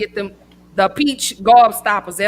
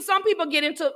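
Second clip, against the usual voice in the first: ordinary talk, nothing marked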